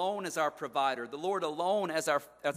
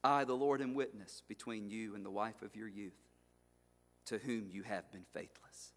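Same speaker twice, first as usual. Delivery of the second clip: much softer, lower, grave; solemn quiet reading; 7 semitones lower, 9 dB quieter than usual. Acted. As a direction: let God's verdict land softly. God's answer read solemnly.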